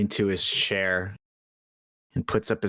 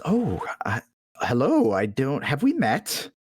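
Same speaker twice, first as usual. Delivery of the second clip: flustered shopkeeper; 5 semitones higher than usual. Acted. Man greets surprised visitor.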